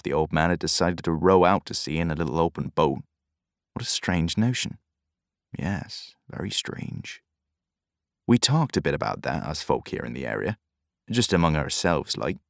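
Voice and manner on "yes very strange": quiet musing. A narrator's reading. Old man musing on strange death.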